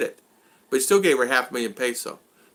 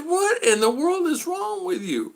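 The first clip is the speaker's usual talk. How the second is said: very high, slow what in the world; incredulous scold; slower than usual. Retold or imitated. Telling the man off, high incredulous scold. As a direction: draw it out high in disbelief. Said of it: "Like that".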